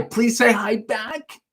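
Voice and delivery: pleading mock shout, slow and high-pitched; mock yell. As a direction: read it out like a pretend scream. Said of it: "You're screaming at me".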